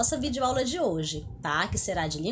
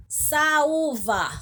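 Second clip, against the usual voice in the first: Saúva with the Ú split from the A and raised in pitch; stressed, separated U; 4 semitones higher, 4 dB louder than usual. Shown as a demonstration. Teacher shows pupils the hiatus, Saúva with a high separate Ú. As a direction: say Saúva leaning on a higher, separate Ú. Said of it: "o U está separado do A".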